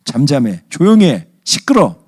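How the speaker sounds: loud, high-pitched irritated commands; scolding. Acted. Jesus rebuking the sea, angry scolding. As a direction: fire off the orders, rising into a yell. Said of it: "이렇게 꾸짖으신 다음에".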